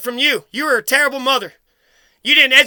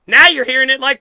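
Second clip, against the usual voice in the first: ordinary talk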